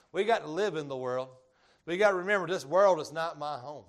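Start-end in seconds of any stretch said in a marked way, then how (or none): 1.8-3.8 emphatic sermon cadence; preaching at full force. Acted. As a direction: drive the point home. Preaching, louder peaks.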